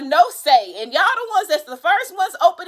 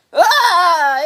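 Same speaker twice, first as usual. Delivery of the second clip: loud, higher cry of dismay; exasperated cry; 5 semitones higher, 6 dB louder than usual. Real, not acted. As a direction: cry out in frustration. Card failed to fly out.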